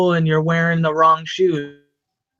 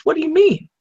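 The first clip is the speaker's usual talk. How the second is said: puzzled; 10 semitones higher, faster than usual. Retold or imitated. Classmate's puzzled question retold.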